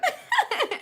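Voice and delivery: high delighted giggle; high squealing laugh. Real, not acted. Laughing at upset dog.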